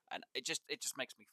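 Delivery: ordinary talk, nothing marked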